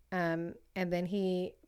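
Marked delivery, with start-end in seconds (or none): none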